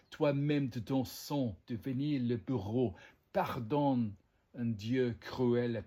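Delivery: measured French tragic verse; dramatic verse recitation. Acted. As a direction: declaim it like lines from a classical play. Recites lines of Racine in French.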